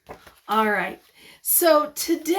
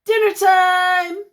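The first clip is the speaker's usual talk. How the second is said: calling out; 8 semitones higher, 8 dB louder, much slower than usual. Acted. Calling everyone to dinner.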